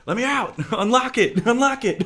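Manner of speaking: high, loud trapped-man cries through laughter; hammed-up panic, breaking into laughs; mock panic. Acting out man trapped in cart.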